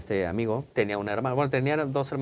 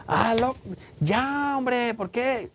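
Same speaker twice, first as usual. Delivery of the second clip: put-on whiny kid complaining; 10 semitones higher, much slower than usual. El hermanito se queja otra vez.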